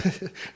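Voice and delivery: short, high embarrassed chuckle; a broker's hammed-up sheepish giggle; sheepish laugh. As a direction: laugh it off awkwardly. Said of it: "lets out this sheepish laugh".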